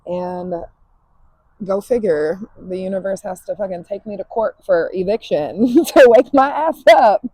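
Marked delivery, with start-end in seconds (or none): 2.6-7.2 laughing exclamation. Real, not acted. Laughing rant about eviction.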